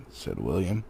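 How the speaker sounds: usual speaking voice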